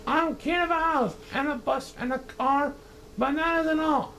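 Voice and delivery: high, pompous royal ranting; thundering king. Acted. Yertle ranting down at Mac, high and pompous. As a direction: lord it over the little turtle. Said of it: "his royal voice thundered".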